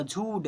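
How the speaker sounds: झूठ said as jhood, without nasal n; model pronunciation. Shown as a demonstration. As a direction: read झूठ softly as plain jhood. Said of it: "ऐसे पढ़ेंगे". (झूठ को बिना अनुस्वार, झूड जैसा बोलें)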